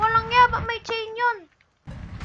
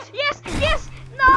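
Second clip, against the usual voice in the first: excited cheer; 9 semitones higher, 5 dB louder, slower than usual. Real, not acted.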